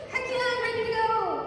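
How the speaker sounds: very high, eager dog voice; excited dog. Acted. Speaker voicing Rose the happy dog, excited. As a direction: squeal it like a dog raring to go. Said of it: "You look like this".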